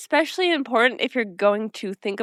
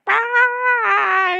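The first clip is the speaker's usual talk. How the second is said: long, high-pitched squeal; mock scream; 3 dB louder than usual. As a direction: imitate an over-the-top excited shriek. Imitating the celebratory scream.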